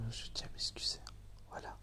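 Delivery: soft unvoiced whisper; whispered apology. Real, not acted. Excuse chuchotée. (excuse chuchotée)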